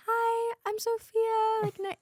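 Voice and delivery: high, slow, sing-song TV intern voice; way up high and slow, overly sweet; customer service voice. Shown as a demonstration. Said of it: "my voice sounds like".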